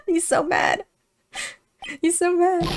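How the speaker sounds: high, squeaky amusement through laughter; gleeful laughing. Real, not acted. Player delights at the angry character, high and laughing.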